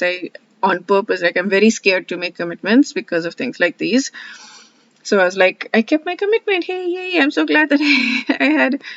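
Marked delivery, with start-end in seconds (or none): 5.7-8.8 mock-gleeful, high-pitched little cheer; high, giddy imitation of celebrating; gleeful self-congratulation. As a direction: act out a delighted, self-satisfied yay with a laugh. Acting out relieved self-congratulation.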